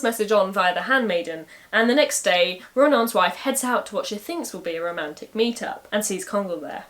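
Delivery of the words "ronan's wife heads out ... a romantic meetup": ordinary delivery, nothing marked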